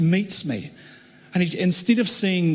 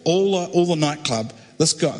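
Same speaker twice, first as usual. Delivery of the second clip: normal talking voice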